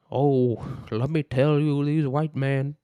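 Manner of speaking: lightly attempted orator voice; hint of a civil-rights orator impression; Martin Luther King Jr. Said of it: "that was M L K".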